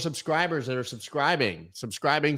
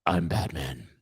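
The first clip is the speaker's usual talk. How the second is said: lightly lowered gruff hero growl; low Batman voice; 6 semitones lower, 4 dB quieter than usual. Pretend. Host mocking Batman for his viewers, low voice. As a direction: drop low like Batman, only a little. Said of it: "low voice".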